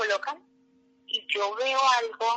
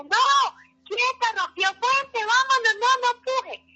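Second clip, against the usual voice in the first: much higher and louder, urgent nurse orders; urgent shout; 14 semitones higher, 7 dB louder, slower than usual. Retold or imitated. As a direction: bark urgent orders in the delivery room. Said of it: "Y cuando me dice". (enfermera gritando órdenes urgentes, mucho más aguda)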